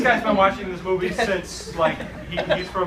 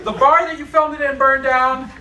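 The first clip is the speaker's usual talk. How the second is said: high, loud, laughing through words; half laughing, can't believe it; laughing disbelief; 8 semitones higher, 5 dB louder than usual. Real, not acted. Laughing disbelief at cursed movie.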